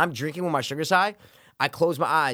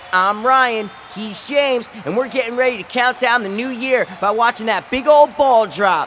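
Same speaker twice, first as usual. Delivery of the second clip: loud, high, hyped-up broadcast announcer; host the Times Square countdown over the crowd; big cheesy live-TV presenter shout; New Year's TV host; 9 semitones higher, 5 dB louder, slower than usual. Acted. Mock New Year's broadcast hosts.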